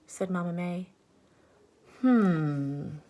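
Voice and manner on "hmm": low considering hum; thoughtful hmm. Acted. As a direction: ponder it for a moment. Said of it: "said Annalisa".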